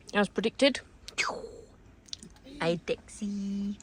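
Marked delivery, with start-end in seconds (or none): 2.6-3.8 soft whisper to the dog; breathy hushed greeting. Real, not acted.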